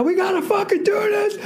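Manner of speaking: high shouted hype, far above normal; mock Sam Jackson scream. Comic imitating Sam Jackson screaming at the crowd. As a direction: scream it like a stadium hype man. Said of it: "Sam Jackson starts fucking screaming".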